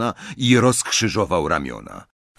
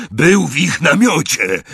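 loud, higher croaking protest; croaked; 7 semitones higher, 6 dB louder than usual. A narrator's reading. Pinned Greyback explains himself to Bellatrix, croaking. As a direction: croak out the excuse loudly. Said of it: "wychrypiał Greyback".

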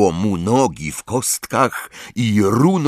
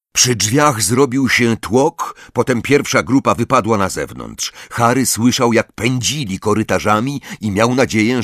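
ordinary delivery

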